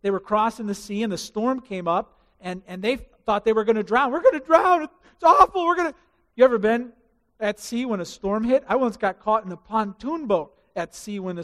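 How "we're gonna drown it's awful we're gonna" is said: high, loud panicked cry; panicked yell. Acted. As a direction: shout it like men about to drown. Panicked disciples in storm.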